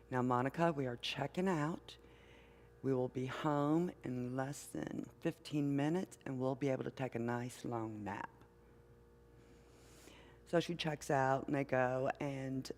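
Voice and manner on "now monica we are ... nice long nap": soft, calm, softer toward the end; gentle mother. Retold or imitated. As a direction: soothe a fussing toddler, very gently. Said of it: "the mother very gently says".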